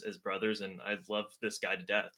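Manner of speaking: normal talking voice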